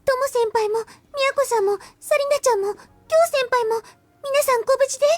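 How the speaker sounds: put-on high, eager young girl; earnest girl voice. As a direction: insist earnestly in a high girlish voice. Girl insists on checking everyone.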